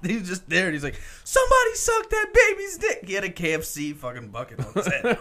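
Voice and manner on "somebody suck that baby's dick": put-on high, loud drunken holler; mock high-pitched scream; coked-up club yell. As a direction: belt it out like a wasted party guy. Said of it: "he got, like, super coked up when he was drinking".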